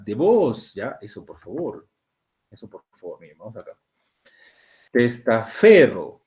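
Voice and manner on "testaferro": testaferro slowly, each syllable drawn out, stress pushed onto fe; forced stress demo. Shown as a demonstration. Teacher forcing the stress on testaferro so students hear it. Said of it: "yo lo estoy forzando".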